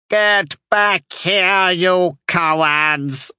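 high, long-drawn witch's cry; commanding call. A narrator's reading. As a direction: call the deserters back, stretching every word. Witch calls after fleeing horde.

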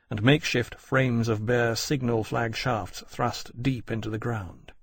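plain reading voice

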